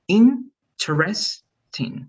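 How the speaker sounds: very slow, spaced interesting; part by part. Shown as a demonstration. Teacher breaks the word into parts for Betsy. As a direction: stretch it out piece by piece. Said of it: "partecita y partecita".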